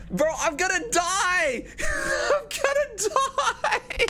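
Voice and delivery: very high panicked laughing cry; panicking gamer. Real, not acted. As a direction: squeal in mock panic at losing. Facing a deadly vote.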